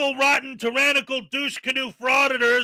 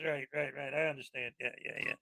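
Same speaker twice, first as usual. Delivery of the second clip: sarcastic muttered agreement; 10 semitones lower, 17 dB quieter, much faster than usual. Acted. Sarcastic mock concession to frauditors.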